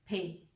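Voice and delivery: फे, soft; German P. Shown as a demonstration. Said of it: "पी को". (फे बोलिए)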